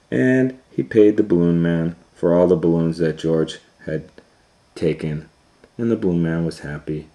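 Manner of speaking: usual speaking voice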